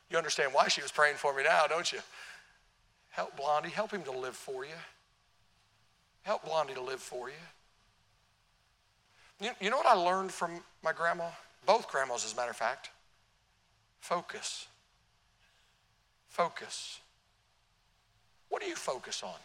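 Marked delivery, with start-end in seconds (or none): 3.1-7.3 hushed, low murmured prayer; lightly voiced grandma praying softly. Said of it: "I'd hear her pray". 14.0-17.0 breathy whisper of focus; hushed emphasis; whispered word. Real, not acted. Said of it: "repeated word, whispered".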